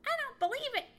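high, loud squirrel snap; snapped. Acted. Red squirrel doubting Johnny's story, snapping. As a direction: snap back in disbelief. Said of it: "he snapped".

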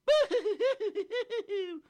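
very high, loud comic boo-hoo wail; cartoon sobbing from inside a tree; fairy boo-hoo sob. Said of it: "sounded like they were very, very sad".

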